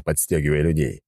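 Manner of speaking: usual speaking voice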